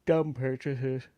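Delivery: slow, yawning through the words. Real, not acted. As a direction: say it mid-yawn, dragged out slowly. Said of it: "Sorry, I'm yawning".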